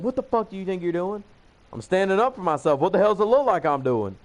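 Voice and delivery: indignant quarrel. Retold or imitated. Dad hauls him away and he answers back, indignant quarrel. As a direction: re-enact a heated exchange.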